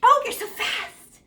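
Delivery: high, drawn-out acted wonder; mock amazement. Teacher acting out getting fast at math homework, mock amazement.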